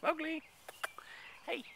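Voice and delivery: bright high pet-calling voice; high call to cat. Real, not acted.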